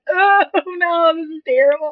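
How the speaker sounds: high, laughing "oh no"; laughing dismay. Retold or imitated. Recalling her reaction to her co-host, high laughing dismay. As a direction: laugh through mock horror. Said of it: "And I was like".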